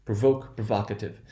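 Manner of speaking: "Provoke" with long O, "provocative" with short open "vahk"; long then short O. Shown as a demonstration. Teacher demonstrating a vowel difference, long O becoming short. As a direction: stress the second syllable, changing "voke" to "vahk". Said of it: "you will hear a little bit of a difference".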